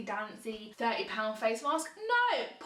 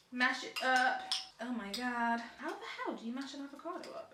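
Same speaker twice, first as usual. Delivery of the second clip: ordinary talk, nothing marked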